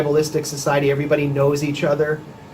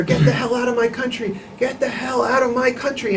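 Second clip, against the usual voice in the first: retold screaming; 9 semitones higher than usual. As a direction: imitate a hostile street chant, pitched way up. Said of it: "just started screaming at her".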